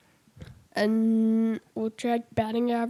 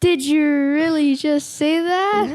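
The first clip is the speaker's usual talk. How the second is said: mock disbelief; 5 semitones higher, 3 dB louder, slower than usual. Catchphrase after saying Uno.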